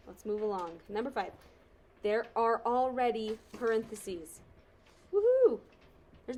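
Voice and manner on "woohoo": loud, high whoop of joy; excited cheer. Real, not acted. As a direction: cheer it out, thrilled. Cheering that parentheses are given.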